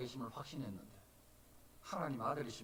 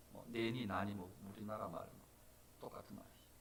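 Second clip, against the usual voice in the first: ordinary delivery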